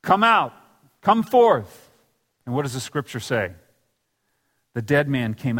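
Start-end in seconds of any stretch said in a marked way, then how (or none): 0.0-1.9 high, loud commanding cry; loud call. Acted. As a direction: call Lazarus out of the tomb at full voice. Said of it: "he says in a loud voice".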